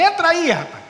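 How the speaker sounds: loud, high, brisk invitation; retold called-out order. Pastor telling walker to get in, called out loudly. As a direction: call him over to the car. Pastor calls him into car.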